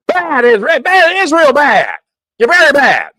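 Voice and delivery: high, loud, hammed-up telling-off; exaggerated fake reprimand; mock scolding. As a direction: scold like at a naughty pet, for show. Acted public show of scolding.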